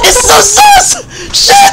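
very high, loud, drawn-out shriek; startled shriek. Real, not acted. Player caught off guard by the infected, shrieking. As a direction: shriek as it jumps out at you.

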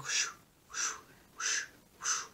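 breathy whoosh of a tennis ball; tennis-match whooshes. Retold or imitated. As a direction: imitate each swing with a soft whoosh. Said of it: "it sounded like a tennis match".

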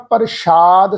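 ਪ੍ਰਸ਼ਾਦ as "par-shaad", with sh for s; wrong SH sound. Shown as a demonstration. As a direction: say ਪ੍ਰਸ਼ਾਦ split, with a sh. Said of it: "ਸੱਸੇ ਨੂੰ ਬਿੰਦੀ ਲਾ ਕੇ". (ਪ੍ਰਸ਼ਾਦ ਨੂੰ "ਪਰ-ਸ਼ਾਦ" ਵਾਂਗ)

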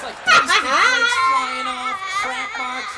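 loud, slow and appalled, laughing through it; mock horrified yell. Comic telling the crowd about flaking skin, mock horrified yell. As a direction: shout it like the skin is crumbling. Exaggerated ashy skin description.

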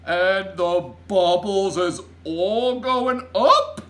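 very loud, slow, high question; booming giant incredulity; aghast giant. A narrator's reading. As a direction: stretch each word in horrified disbelief. Giant voiced, aghast at Sophie's answer.

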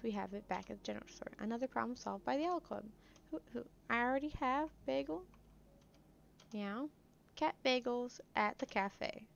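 ordinary talk, nothing marked

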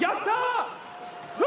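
high, slow victory cheer; joyful cheer. Real, not acted. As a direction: cheer it out like you just won. Winner celebrating in the ring.

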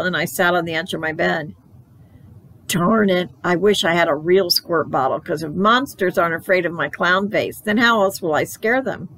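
usual speaking voice

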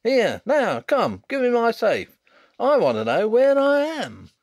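blustering bookmaker. Acted. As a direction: demand your safe like an outraged bookmaker.

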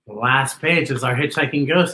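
usual speaking voice

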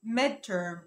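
midterm said slowly as medter; slow correct pronunciation. Shown as a demonstration. Teacher corrects midterm for viewers, slowly. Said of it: "اسمها midterm".

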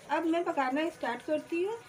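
usual speaking voice

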